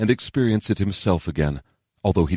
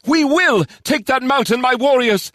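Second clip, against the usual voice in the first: high, loud battle cry; rally the troops, high and determined; ringing high-pitched command; 5 dB louder than usual. A narrator's reading. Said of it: "the lyric cried out".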